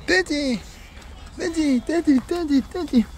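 very high repeated pet call; pet-name cooing. Real, not acted. Owner cooing the dog's nickname over and over, high baby talk.

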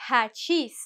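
hachís with the H rasped like a Spanish J. Shown as a demonstration. Teacher modelling a borrowed word for the class, H like J. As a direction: breathe the H of hachís like a J. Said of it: "as if in Spanish I would be using a J".

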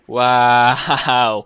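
very loud drawn-out yell; exclaiming. Real, not acted. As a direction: burst out at a near miss. Near miss in the chase.

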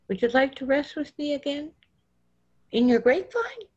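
put-on child eagerly asking about grapevine. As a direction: bounce with childlike delight.

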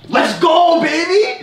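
shouting in triumph; big hyped-up celebration; excited cheer. Real, not acted. Celebrating a win.

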